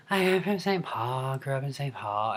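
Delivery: soft, babyish valley-girl mimicry; mock baby-voiced model; breathy baby-talk valley girl. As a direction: put on a tiny, breathy little-girl voice. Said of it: "do not sound like that".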